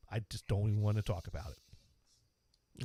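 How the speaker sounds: low, soft brush-off; retold weary refusal. Classmate turning away his apology, quietly dismissive. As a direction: quietly refuse the conversation, without anger. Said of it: "he didn't get angry at me".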